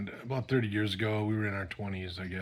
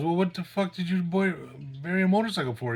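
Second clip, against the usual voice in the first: higher-pitched mock disbelief; retold exasperation; 7 semitones higher, 3 dB louder than usual. Cop questioning friend about the buried bike, exasperated. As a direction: demand an explanation as the baffled cop.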